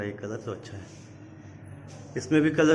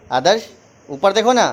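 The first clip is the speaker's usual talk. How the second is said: calling out; 5 semitones higher, 8 dB louder than usual. Real, not acted. Uncle calling Adarsh to look up, loud calling. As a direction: call the name out high and loud.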